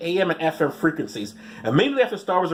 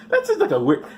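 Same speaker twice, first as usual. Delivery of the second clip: normal talking voice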